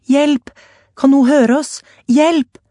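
desperate high, loud cries; screaming for help. A narrator's reading. Alania pounding the wall, desperate screams for help. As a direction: scream for anyone outside. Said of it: "mens hun hylte".

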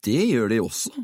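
careful, hesitant addition; cautious. A narrator's reading. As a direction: add it gingerly. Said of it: "tilføyde han forsiktig". (forsiktig innskutt)

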